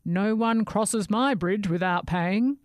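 menacing bridge troll; guard the bridge like a troll. Acted.

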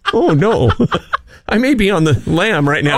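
spoken through laughter; joking about being a fugitive, laughing. Real, not acted. Laughing at own story.

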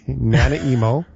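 slow "Nanaimo" said as NA-na-EE-mo; mispronounced as Nana Emo. Shown as a demonstration. Host repeating the joke to co-host, "Nanaimo" mispronounced as NA-na-EE-mo. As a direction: stretch "Nanaimo" into nana-emo after a laugh. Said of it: "My emo grandmother?".

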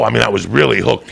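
normal talking voice

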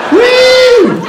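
very high, loud "woo"; piercing mock whistle call; imitated whistle. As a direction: fake a whistle with your voice. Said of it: "that cool whistle?".

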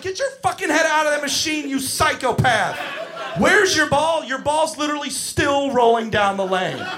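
loud, high incredulous rant; exasperated yelling. Retold or imitated. Counselor to kid with head in the ball machine, furious exasperated yelling. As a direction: berate in outraged disbelief.

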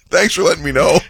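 cracking up while saying it; laughter over a friend's cheap text; laughing. Real, not acted.

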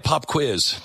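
usual speaking voice

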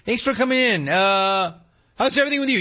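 high, sugary greeter voice; high mock-chirpy cashier greeting a customer; cheery greeter. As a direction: play the overly friendly clerk. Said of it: "If somebody's like".

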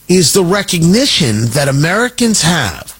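ordinary talk

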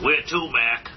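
cabbie voice. Retold or imitated. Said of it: "the guy said".

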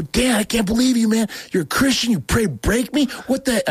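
high, indignant outburst at a friend; mock-furious protest at Dan; retold outrage. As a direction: sputter in disbelief, voice jumping high. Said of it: "so mad".

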